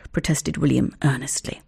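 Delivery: ordinary delivery, nothing marked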